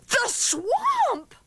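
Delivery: put-on high, horrified kids' cry; alarmed cry. A narrator's reading. Kids recognizing the dreaded substitute, horrified cry. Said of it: "cried the kids".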